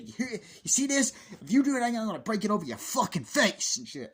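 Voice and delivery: high, loud ranting threat; mock furious threat. Adult threatening boy over the mask, mock furious yelling. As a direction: imitate an enraged adult threatening a kid.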